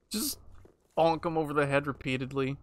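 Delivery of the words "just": ordinary delivery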